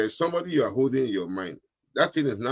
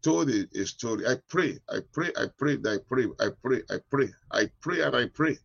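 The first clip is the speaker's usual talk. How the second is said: usual speaking voice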